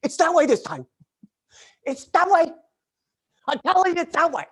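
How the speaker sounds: high-pitched acted yelling; mock exasperated insistence. Parking guy to doubting churchgoers, acted exasperated shout. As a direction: insist loudly, as if repeating to doubters.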